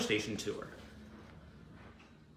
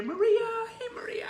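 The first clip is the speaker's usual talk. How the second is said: calling out a greeting; 6 dB louder than usual. Real, not acted. Host greets Maria as she joins, calling out high and excited. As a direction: sing out the name in delight. Greets viewer joining the stream.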